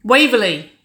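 loud, high call from another room; brash call. A narrator's reading. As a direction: holler the name through the house. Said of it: "Wynonna's brash voice called from the kitchen".

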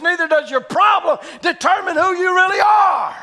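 loud, high-pitched sermon delivery; full-force preaching. Real, not acted. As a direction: preach it out to the whole congregation.

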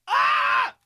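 sudden high shriek, unvoiced; shout. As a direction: yelp in horror. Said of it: "I'd be like".

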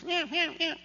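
high, soft mewing meows; cat meows. Retold or imitated. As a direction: mimic a trapped kitten's thin mew. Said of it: "it's still going".

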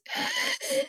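sharp high gasp imitating a child choking; a sudden desperate inhale, then silence. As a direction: suck in air sharply then stop. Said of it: "intense inhale".

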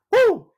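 high, loud preacher's whoop of joy; joyful whoop. Real, not acted. Preacher exults to the church over the resurrection, whooping. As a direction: let out a sudden joyful holler.

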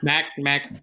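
usual speaking voice

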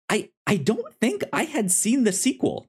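ordinary delivery

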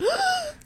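sharp high gasp of shock; a theatrical horrified gasp; mock gasp. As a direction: gasp like your heart just broke. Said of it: "And she's like".

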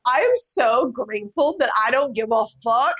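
high, laughing retort ending in a giggle; laughing disbelief. Retold or imitated. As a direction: laugh it out in amazed relief. Retold reaction to racist snub.

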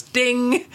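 high, held bell-like ding; imitated ding. Lie detector signals a true answer, high imitated ding. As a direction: sing out a high chime. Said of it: "they have a sound that goes".

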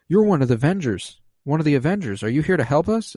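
breathless hope, lightly acted; excited retold Agnes. Agnes realizing Vision is an Avenger, eager and high. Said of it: "And she gasps and looks to Vision and says".

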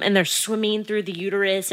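ordinary talk, nothing marked

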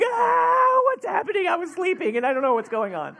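high, loud panicked wail easing off; screaming child. Retold or imitated. Little brother jolted awake in the bunk, mock screaming. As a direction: scream awake in confusion, then come down in pitch. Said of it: "he starts screaming".

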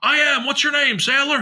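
high, eager, bubbly greeting; imitating an overjoyed officer; super excited. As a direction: greet the sailor with mock delight. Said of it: "He was, like, super excited".

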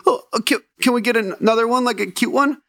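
high-pitched imitation of a six-year-old's question; lightly voiced little girl, hesitant and high; adorable child. Six-year-old daughter asking dad for a new cat, adorable child. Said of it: "it was such an adorable statement".

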